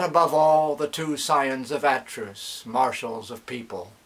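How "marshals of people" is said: usual speaking voice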